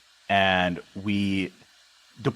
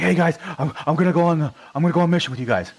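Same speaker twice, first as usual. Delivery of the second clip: high, louder imitation of a major; eager overweight major; 9 semitones higher, 5 dB louder, faster than usual. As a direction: play a major inviting himself along. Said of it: "overset weight major".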